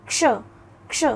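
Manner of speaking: क्ष said clearly, with both क and श heard; model pronunciation. Shown as a demonstration. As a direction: demonstrate क्ष with the क held, then श released. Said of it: "दोनों ही ध्वनियों का उच्चारण हो रहा है". (क्ष का साफ़ उच्चारण, क और श दोनों सुनाई दें)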